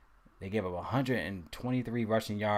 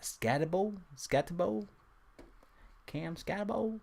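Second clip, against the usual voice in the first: Scatabo said high, rising like a question; questioning tune; 10 semitones higher than usual. Real, not acted. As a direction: try out "Scatabo" with an unsure upward lift. Unsure how to pronounce the name.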